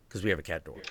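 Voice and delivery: normal talking voice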